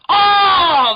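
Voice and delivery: long, high, loud "oh"; imitated shout. Both kids as Godzilla goes over the edge, imitated shout. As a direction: bellow out the kids' drawn-out cry. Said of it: "They both audibly do that noise".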